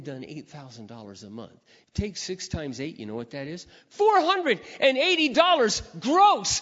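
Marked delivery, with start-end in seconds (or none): 3.9-6.6 high, loud and slow, every word hit; indignant punchline. Real, not acted. A preacher telling the crowd his real pay, loud and slow. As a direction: land the sad number hard.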